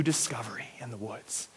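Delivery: hushed secretive whisper. A narrator's reading. Storyteller building suspense for the crowd, whispered. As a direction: breathe it like a secret.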